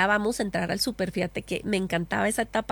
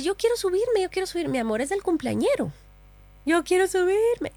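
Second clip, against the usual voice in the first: put-on much higher, pleading little boy; whiny child; 9 semitones higher than usual. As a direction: whine for the toy ride. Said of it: "él empezó a decir".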